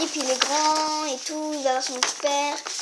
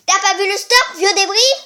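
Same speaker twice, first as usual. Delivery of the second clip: raised, scornful jeer; angry insult; 5 semitones higher, 6 dB louder than usual. Acted. Oedipus insulting the old driver, scornful. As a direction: sneer at the old man.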